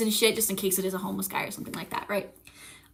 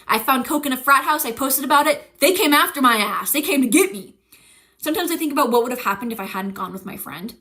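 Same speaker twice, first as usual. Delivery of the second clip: ordinary delivery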